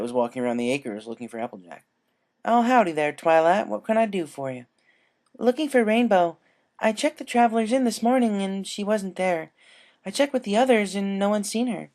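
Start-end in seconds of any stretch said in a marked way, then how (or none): 2.4-4.7 lightly voiced country mare greeting a friend; friendly howdy. A narrator's reading. Applejack greets Twilight. 5.4-11.9 higher, earnest voice of a searching friend; anxious but polite; worried Twilight. A narrator's reading.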